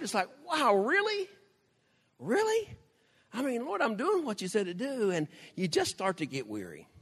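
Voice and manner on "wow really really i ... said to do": mock disbelief, tossing the really up high; incredulous pleading. A weary believer pleading with God in high disbelief. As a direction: pitch jumping way up on each really, half pleading with God. Voicing exasperated prayer to God.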